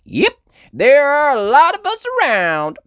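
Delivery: friendly diner cowgirl; Southern cowgirl. Acted.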